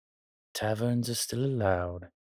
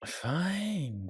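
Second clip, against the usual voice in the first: giving in; 8 semitones higher than usual. Acted. Gives in to companion's wish.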